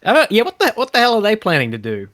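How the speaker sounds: ordinary talk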